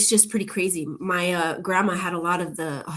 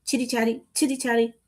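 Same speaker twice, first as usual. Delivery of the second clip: sing-song teasing; 5 semitones higher than usual. Pretend. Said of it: "I just ramble on".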